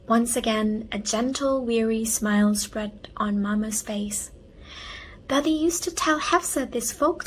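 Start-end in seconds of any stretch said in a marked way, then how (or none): none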